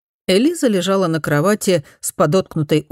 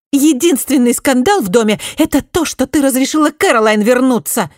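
much higher, louder angry snap; furious retort; 9 semitones higher, 5 dB louder than usual. A narrator's reading. Daughter furiously snapping back at her mother, high and loud. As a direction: bite back, red with fury. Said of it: "Раскрасневшись от ярости, огрызнулась".

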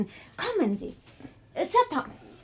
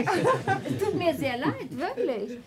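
slow, high, laughing through the words; laughing apology; 6 semitones higher, slower than usual. Acted. Laughing while apologizing. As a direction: hostess laughing off her guests' looks.

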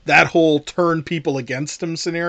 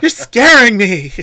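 loud, high, drawn-out mock wail; Barbara impression; 8 semitones higher, 11 dB louder than usual. Podcast host redoing the heroine for laughs, hammed-up wail. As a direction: ham up the scared horror heroine, slow and loud. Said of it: "your Barbara impression".

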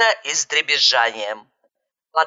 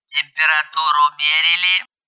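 disdainful, unmoved inquiry; squeamish disdain; 3 semitones lower than usual. A narrator's reading. Dispatcher quizzing a frantic caller, disdainful. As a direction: ask with fastidious distaste. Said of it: "брезгливо поинтересовался голос".

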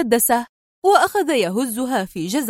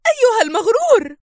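high furious scream; 12 semitones higher than usual. A narrator's reading. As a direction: shriek the insult at him. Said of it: "صرخت". (صرخة غاضبة حادة)

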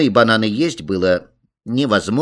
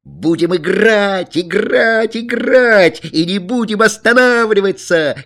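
very high, loud, stretched chanting cry; jubilant, drawn-out childish yell; children shouting; 10 semitones higher, 5 dB louder than usual. A narrator's reading. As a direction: clamour like kids who refuse to stop. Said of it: "кричали братья".

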